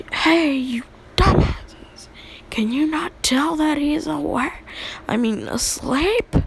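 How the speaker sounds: high, loud mock-angry outburst; fuming. Furious classmate calling out the sleeper to everyone, mock rage. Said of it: "he was just mad".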